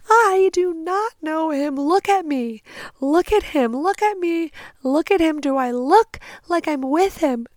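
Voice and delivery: high, insistent mock wail; retold hysterical sobbing. Victim telling bystanders he is a stranger, hammed-up hysterics. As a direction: protest in an acted-out hysterical cry. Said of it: "I am sobbing".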